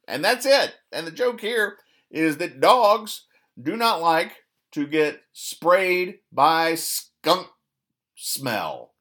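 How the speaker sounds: high, slow, drawn-out sarcastic explanation; mock exasperated explainer. Real, not acted. Host explaining a dull comic to listeners, mock exasperated. Deadpan explaining a weak joke.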